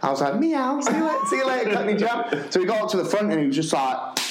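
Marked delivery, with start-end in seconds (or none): 0.4-2.5 high, sing-out mocking goodbye; teasing send-off. Teacher teasing his colleague walking up to pray, high mocking call. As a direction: taunt your mate in a high voice.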